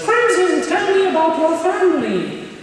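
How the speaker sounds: put-on high old witch voice calling names; old witch teacher. Imitating the strict teacher calling on each student. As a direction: squeak each name like a little witch. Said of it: "she talked like the witch from".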